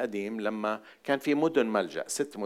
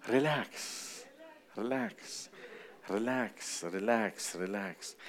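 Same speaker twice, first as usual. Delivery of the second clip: soothing fade; 9 dB quieter than usual. Acted. Preacher repeating relax soothingly.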